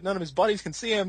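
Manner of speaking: usual speaking voice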